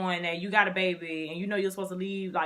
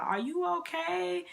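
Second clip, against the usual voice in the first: concerned stranger; 10 semitones higher than usual. Retold or imitated.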